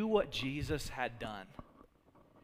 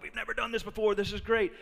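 excited disciples; 5 semitones higher, 4 dB louder, faster than usual. Retold or imitated. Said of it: "they're excited".

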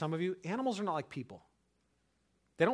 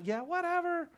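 high flippant brush-off; dismissive. Real, not acted. Pastor scoffing at churchgoers' resolutions, high and dismissive. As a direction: wave it away, scoffing. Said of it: "dismissive aside".

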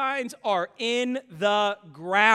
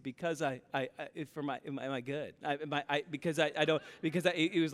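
put-on lower, softer stammering little boy; stuttering child; 4 semitones lower, 7 dB quieter, faster than usual. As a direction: stammer it out confused and unsure. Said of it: "stuttering everything else".

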